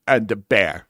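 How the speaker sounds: holding back a burp; words squeezed past a held burp; stifled burp. Real, not acted. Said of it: "Why are you saying it like that?".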